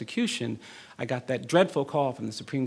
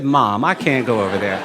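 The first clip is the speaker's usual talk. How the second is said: louder, laughing through the whine; laughing protest; 12 dB louder than usual. Retold or imitated. Boy protesting to his mother.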